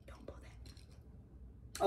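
whispered to herself. Real, not acted. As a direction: breathe it as a private note. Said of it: "note to self".

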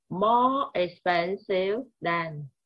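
teacher drilling the comparative slowly. Shown as a demonstration. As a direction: drill the phrase word by word. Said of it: "slow drill of the form".

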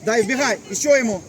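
loud coaching calls; shouted instructions. Real, not acted. Coach urging players on in play, loud calls. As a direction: shout short orders to the players.

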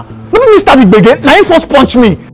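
high-pitched protest; indignant self-defence. Acted. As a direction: protest that the other man started it. Defends himself in quarrel.

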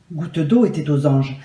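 ordinary delivery, nothing marked

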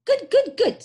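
high, loud birdlike screech. Acted. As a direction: screech it in short squawking bursts. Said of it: "screeched Billy".

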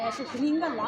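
ordinary delivery

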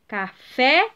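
"Café" in separate syllables, rising onto "fé"; stress on last syllable. Shown as a demonstration. Teacher repeating the word for pupils, stressing final "fé" in "Café". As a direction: split "Café" and lean into "fé". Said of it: "é a última sílaba tônica".

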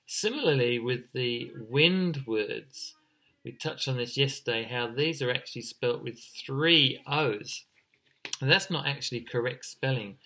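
ordinary delivery

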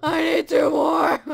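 slow, loud shout; loud demand. Real, not acted. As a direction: shout each word, greedy.

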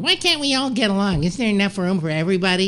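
high-pitched mocking plea, speeding up at the end; mimicked pleading Leno. Retelling Jay's endless appeal to Dave, mock pleading. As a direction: put on a high, pleading impression. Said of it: "because Jay was always going".

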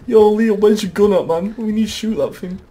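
high, loud panicked urging; urgent yelling. Real, not acted. Player urging Leon to shoot the monster, urgent yelling. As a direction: yell for the gun in alarm.

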